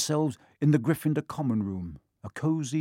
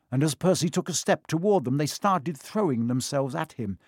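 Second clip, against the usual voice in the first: plain reading voice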